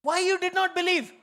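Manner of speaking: high, loud mock rebuke; playful high rebuke; rebuking Jesus. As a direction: scold for unbelief, pitch way up. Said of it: "he rebuked them".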